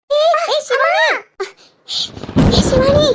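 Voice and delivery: sudden frightened cry of her name; alarmed shout. Acted. Friend falls, calling her name. (பதறி உரக்கக் கூப்பிடுதல்)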